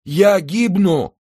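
drowning man crying out, high and slow; weak cry. A narrator's reading. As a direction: cry out as your strength fails. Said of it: "слабо крикнул Мордаунд".

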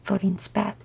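ordinary delivery, nothing marked

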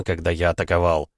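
ordinary delivery, nothing marked

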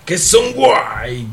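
stern uncle shouting at his niece. Acted. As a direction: warn her, voice rising to a yell. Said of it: "gridò Isai".

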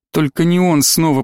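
usual speaking voice

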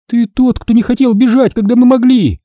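high-pitched angry accusation; heated outburst; exploding in anger. A narrator's reading. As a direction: burst out accusingly, voice right up high. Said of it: "взорвалась Сири".

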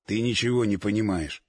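low weary man. Acted. Oleg's low voice in dialogue.

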